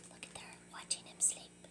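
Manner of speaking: fast whispered aside. Real, not acted. Reader whispers an aside about the sister. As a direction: whisper it to the listener.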